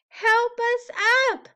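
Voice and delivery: high, loud call for help; cry for help. A narrator's reading. Little letters A B C crying for help. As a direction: call out from the pile.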